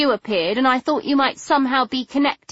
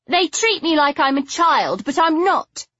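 stubborn; 8 semitones higher than usual. Acted. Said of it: "her chin taking a suddenly stubborn cast".

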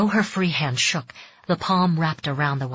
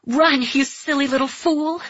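high, urgent scolding cry; inner voice crying out; 9 semitones higher than usual. Acted. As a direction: shout a frantic warning. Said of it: "a voice within Mariko cried out".

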